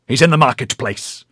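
loud, carrying announcement; announcing. A narrator's reading. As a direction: call it out across the village. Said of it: "the bandit announced across the whole village".

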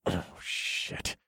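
very soft, voiceless muttered curse; muttering to oneself. A narrator's reading. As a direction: mutter it under your breath, barely audible. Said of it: "I muttered to myself".

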